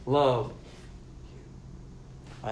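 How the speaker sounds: "love you" whispered, barely voiced; almost silent. Shown as a demonstration. Preacher demonstrating agape with a whispered "love you". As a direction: let "love you" fall to a soft whisper. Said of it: "very silently".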